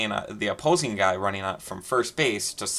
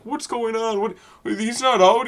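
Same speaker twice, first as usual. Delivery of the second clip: confused bench; 11 semitones higher than usual. Retold or imitated. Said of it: "everybody on the field is confused".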